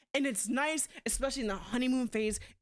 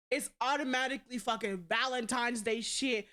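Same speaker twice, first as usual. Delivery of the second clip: usual speaking voice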